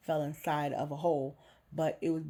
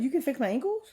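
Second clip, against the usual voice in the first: surprised; 9 semitones higher, 7 dB louder, faster than usual. Retold or imitated. Disbelief at surgeon's offer.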